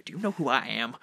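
lightly voiced vain character's catchphrase; Klaath. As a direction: say it as a self-important man, slightly put on. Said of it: "I can just talk as Clav".

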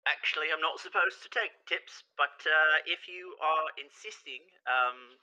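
high, coy ferryman voice; childlike mischief, pitched high; mischievous grin. Acted. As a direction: pretend reluctance through a devilish grin. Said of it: "this super devilish grin across his face".

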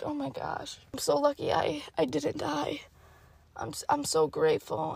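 soft, faint pretend gratitude; hushed, play-acted relief; weak patient voice. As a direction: murmur it like a patient in bed. Imagined patient in hospital bed.

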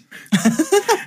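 a sharp high burst of real laughter. Real, not acted. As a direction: laugh in disbelief. Laughing at grease paint memory.

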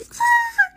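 short, very high squeal; tiny piercing squeak of delight. Retold or imitated. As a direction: imitate a delighted fangirl squeal.

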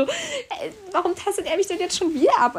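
high, loud indignant complaint; retold fed-up protest; exasperated. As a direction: protest in sheer disbelief. Exasperated thought at repeated prodding.

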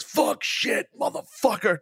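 a burst of curses, the last one jumping way up; mock cursing promo. Retelling his worst promo as a burst of swearing. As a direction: spit out curses like a furious wrestler. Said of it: "the promo was basically this".

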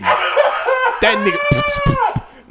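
loud, high laughing shout; cracking up. Real, not acted. Friend losing it at the astronaut food line, cracking up. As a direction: get the words out through helpless laughter.

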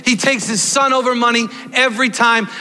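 forceful preaching. Real, not acted. Preacher insisting parents pick son over money, forceful preaching. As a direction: drive the point home to the whole room.

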